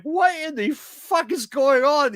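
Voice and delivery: high, slow, stunned disbelief; baffled disbelief. Retold or imitated. Viewer lost in a weird episode, slow baffled high outburst. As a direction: drag out the confusion as if lost. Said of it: "you're just like".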